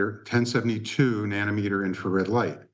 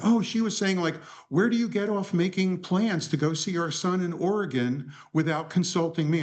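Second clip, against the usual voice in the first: high, breathless rush of shock; upset gushing; 8 semitones higher than usual. Retold or imitated. Assistant rushing back into the office, high and frantic. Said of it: "comes back to the office and is tears".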